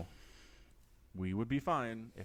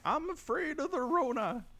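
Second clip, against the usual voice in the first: put-on old man voice. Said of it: "if somebody old called and was like".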